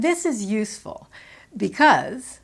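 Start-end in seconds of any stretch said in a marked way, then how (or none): 1.6-2.4 "because" punched louder and set apart; emphatic, louder "because" with a falling end; stress on BECAUSE. Shown as a demonstration. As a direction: hit "because", then pause. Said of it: "and there I stress the conjunction".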